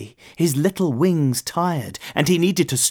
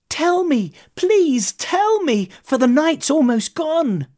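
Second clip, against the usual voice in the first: very high, urgent begging; impatient high moth voice; pleading little moth. A narrator's reading. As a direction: beg for the secret, pitched way up. Moth begs owl for the secret.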